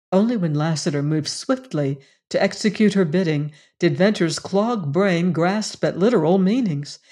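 usual speaking voice

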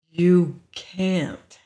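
slow, separated words. A narrator's reading. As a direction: spell it out as if to a child. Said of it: "he said very slowly".

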